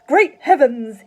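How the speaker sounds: high, very loud exclamation; loud, startled cry from a jittery father; cried out. A narrator's reading. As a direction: blurt it out in alarm. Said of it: "he cried".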